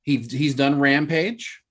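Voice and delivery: ordinary delivery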